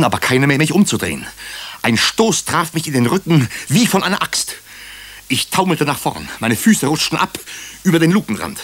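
a narrator swept up in terror, voice climbing; mounting panic. A narrator's reading. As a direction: tell the fall in rising alarm. Narrator recounts being pushed into shaft.

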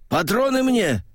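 high, hoarse shout; hoarse yell. Acted. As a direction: yell it hoarse over the gunfire. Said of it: "хрипло заорал красноармеец Велиханов".

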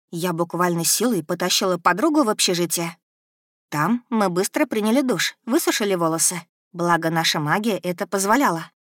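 plain reading voice

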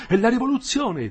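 put-on old man alarmed as he shuts the door; alarmed cry.

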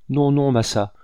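high, loud cry of refusal. A narrator's reading. Terrified woman refuses the hunter, crying out. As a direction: cry out to fend him off. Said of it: "s'écria-t-elle".

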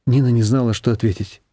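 ordinary delivery, nothing marked

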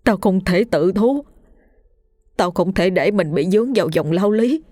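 anguished, faltering pleading; desperate and upset, rising towards the end; distressed refusal. Acted. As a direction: plead that there is no way out, breaking between phrases. Panicked refusal to turn himself in.

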